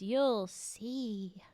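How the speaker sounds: "you'll see" with see leaned on heavily; stress on see. A narrator's reading. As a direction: press down on see. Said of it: "See in bold".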